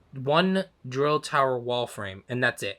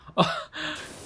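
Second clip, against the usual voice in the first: whispery unvoiced giggle; breathy laugh; 4 dB quieter than usual. Real, not acted. Laughs at fussy client message.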